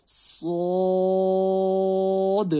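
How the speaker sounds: صاد chanted long and full, high and loud; teacher modelling a thick صاد; heavy stretched letter. Shown as a demonstration. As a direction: recite صاد heavy and drawn out. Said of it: "اس کو موٹا پڑھنا ہے".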